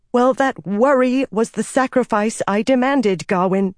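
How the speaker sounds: high-pitched exasperated rebuke. A narrator's reading. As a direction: spell it out, losing patience.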